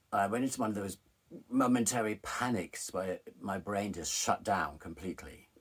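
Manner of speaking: ordinary talk, nothing marked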